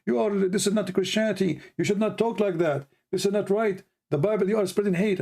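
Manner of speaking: high-pitched mock scolding, like preachy critics; mocking scolding Christians. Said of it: "they will say to you".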